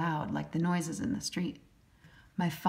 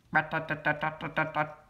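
rapid tapping rat-tat; woodpecker tapping; 2 semitones lower than usual. A narrator's reading. Narrator imitating a woodpecker in the park. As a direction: rattle it like a little hammer. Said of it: "sounds like a little hammer".

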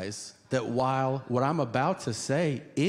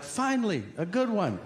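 high-pitched, thrilled relief; excited; 7 semitones higher than usual. Acted. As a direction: act out eager delight, pitched well up. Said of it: "excited to call".

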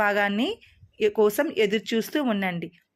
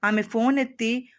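usual speaking voice

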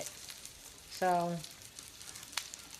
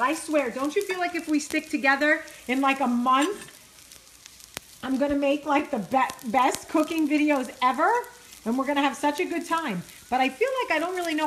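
higher and louder, gushing with enthusiasm; excited; 6 semitones higher, 5 dB louder than usual. Real, not acted. To her followers, promising great cooking videos, excited and gushing. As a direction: rev up the viewers about cooking together.

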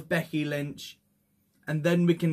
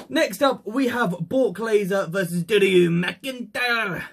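raised, drawn-out match announcement; ring announcer; 4 semitones higher, 3 dB louder than usual. Acted. Host announcing the next match to viewers, ring announcer. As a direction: build to a big stretched final name.

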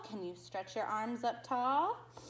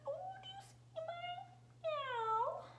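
cat voice; 7 dB quieter, faster than usual. Acted. Cat page, mimics cat.

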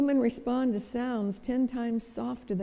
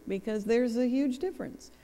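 ordinary talk

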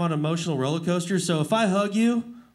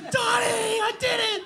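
high, excited re-enacted cry; excited calling out. Clown telling how he yelled to Donnie, excited high cry. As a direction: re-enact a high-pitched triumphant yell to a friend.

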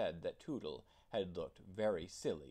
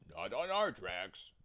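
louder, firm watchman; 6 dB louder than usual. A narrator's reading. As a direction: flatly deny it. Day watchman voiced.

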